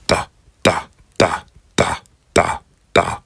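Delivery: "da, da" with a hard dental d; emphasized d. Shown as a demonstration. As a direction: lean on the D in "da". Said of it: "More emphasis on the D sound".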